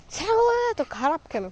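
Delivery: frustrated. Real, not acted. Frustrated at goat.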